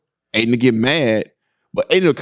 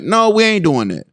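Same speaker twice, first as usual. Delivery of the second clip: normal talking voice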